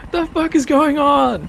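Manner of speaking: high, loud confused pilot voice; baffled pilot. Acted. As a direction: squeak out the pilot's disbelief. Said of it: "the pilot's just like".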